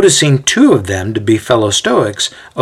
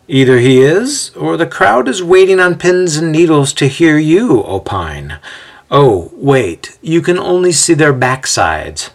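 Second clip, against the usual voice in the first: raised, teasing mock realization; smirking jibe; 6 semitones higher than usual. A narrator's reading. Xander seizing his chance to mock Ezio, smirking. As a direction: needle him with a smirk in the voice. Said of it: "he says with a smirk".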